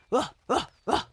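short, high, loud barks; three sharp barks from a sheepdog; dog barks. A narrator's reading. Sheepdog barks after his advice.

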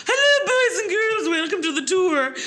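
very high, bright-eyed elf greeting through a laugh; squeaky cheerful elf host; chirpy elf voice. Retold or imitated. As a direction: put on a high perky elf voice. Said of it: "as her, I think her name was Bobby the elf".

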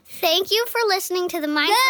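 loud, fast shout. Pretend. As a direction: belt it out quickly. Child shouting podcast thanks.

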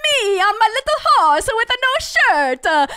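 high, hammed-up Italian accent; boastful cartoon dictator in a bad Italian accent; singsong fake Italian, pitched well up; mock Italian accent. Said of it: "And has an Italian accent".

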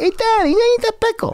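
put-on high squeaky kid asking his dad; little kid voice. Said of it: "the kid was asking the dad".

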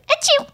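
high squeaky fake sneeze; a tiny pitiful achoo; pathetic sneeze. Shown as a demonstration. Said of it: "a really pathetic sneeze".

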